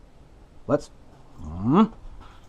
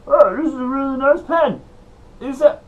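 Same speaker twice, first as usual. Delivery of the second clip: much higher, strained comic judge voice; Bobcat Goldthwait impression; 12 semitones higher, 3 dB louder than usual. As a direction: do a raspy-free but squeaky comedian judge. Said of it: "Bobcat Goldthwait is the judge".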